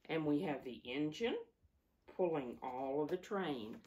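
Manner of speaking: ordinary delivery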